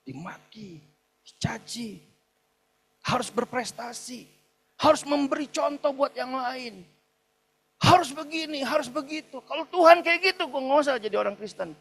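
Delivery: imitating someone fed up, pitch climbing steeply; acted bitter outburst. Acted outburst against a harsh father.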